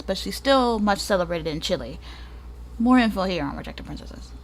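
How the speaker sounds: ordinary delivery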